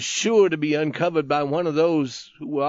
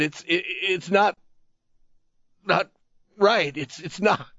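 dazed rambling; 3 semitones higher than usual. A narrator's reading. Dying man stammers at the youth, dazed stumbling plea. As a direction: push the words out in a daze. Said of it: "his words were pushed together".

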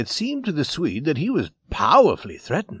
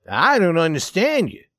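usual speaking voice

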